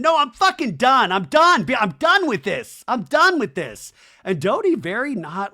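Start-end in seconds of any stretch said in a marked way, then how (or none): 0.0-3.9 loud, high, insistent mock yell; imitated screaming. Janet retold screaming that she's done, mock yell. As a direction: shout it over and over, hammering "done". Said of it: "Janet keeps screaming, screaming".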